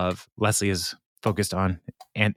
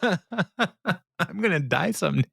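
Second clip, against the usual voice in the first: "I'm gonna die someday" repeated much higher and slow through laughter; laughing re-quote; 8 semitones higher, slower than usual. Retold or imitated. Host re-quoting Tom to the co-host, laughing. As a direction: savour the line while cracking up. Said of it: "That was profound".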